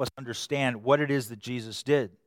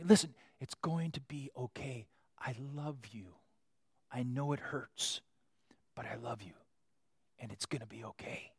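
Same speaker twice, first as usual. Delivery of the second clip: much softer, gentle comfort; tender reassurance; 2 semitones lower, 12 dB quieter than usual. Acted. God comforting someone in pain, soft and tender. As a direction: whisper comfort to a hurting child.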